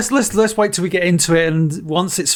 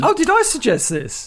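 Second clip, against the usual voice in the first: high-pitched surprised question; genuine surprise; 8 semitones higher than usual. Real, not acted. Co-host told he picked the film, real surprise. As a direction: ask it incredulously, pitch jumping up. Surprised at own forgotten suggestion.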